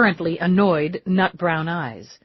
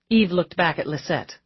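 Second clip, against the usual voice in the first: plain reading voice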